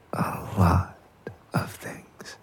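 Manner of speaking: fading into a whisper; soft teasing whisper. Acted. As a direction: drop it to a hush, teasing. Teasing repeat, whispered softly.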